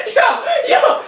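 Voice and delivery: very high, loud laughing yelp; squealed disbelief. Real, not acted. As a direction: yelp it in shocked amusement. Laughing disbelief at own mannerisms.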